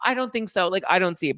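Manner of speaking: high-pitched coy denial; mock false modesty. Contestant brushing off lookalike compliment, mock modest. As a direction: deny it coyly in a put-on high voice.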